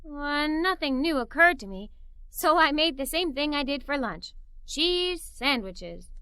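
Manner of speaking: usual speaking voice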